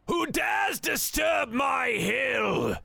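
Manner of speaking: slow, high booming villain shout; villain yell. Acted. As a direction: bellow it like a wrathful dark rider. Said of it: "And it yells out".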